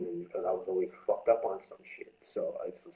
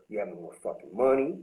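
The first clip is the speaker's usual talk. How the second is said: ordinary delivery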